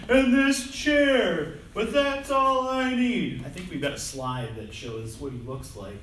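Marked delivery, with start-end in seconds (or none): 0.1-3.4 high, loud, slow simple-minded whine; dopey movie character. Retold or imitated. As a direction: stretch every word like a clueless man-child. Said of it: "continues to pick these random things".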